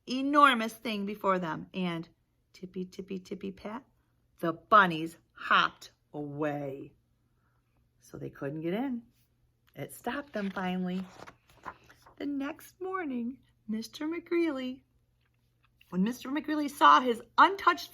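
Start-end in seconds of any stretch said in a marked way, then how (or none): none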